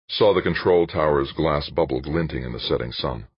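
ordinary delivery

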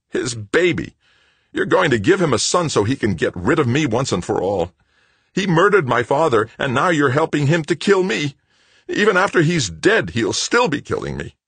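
loud, high, anguished tirade; frenzied accusation. A narrator's reading. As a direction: rant as if betrayed, near hysteria. Son's frenzied accusation of mother.

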